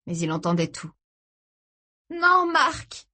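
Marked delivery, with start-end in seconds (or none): 2.1-3.1 high, pleading order; urgent command. A narrator's reading. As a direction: tell him to stop, voice pitched way up. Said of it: "le somma-t-elle". (sommation affolée)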